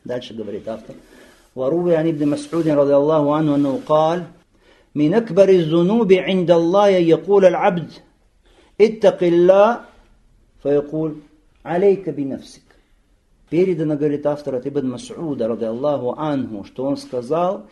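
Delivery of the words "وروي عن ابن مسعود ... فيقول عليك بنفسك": fast, loud, chanted reading of the Arabic text; Arabic text recitation. Acted. Teacher recites the Arabic narration to students, fast and loud. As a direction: recite the Arabic quickly and forcefully.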